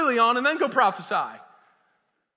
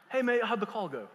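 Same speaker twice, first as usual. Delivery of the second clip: loosely imitated English accent, could pass for Australian; 3 dB quieter than usual. Preacher redoes his English friend's call, shaky English accent. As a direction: have a go at an English mate's greeting. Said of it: "Is that Australian or is that English?".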